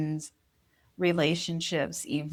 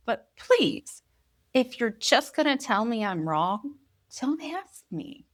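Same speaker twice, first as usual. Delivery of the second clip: high-pitched, exasperated parental plea; exasperated pleading; 5 semitones higher than usual. Retold or imitated. Mother begging her daughter to stop asking, exasperated plea. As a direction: plead with mock-strained patience, pitch climbing at the end.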